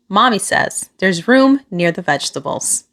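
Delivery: ordinary delivery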